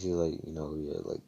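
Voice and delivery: usual speaking voice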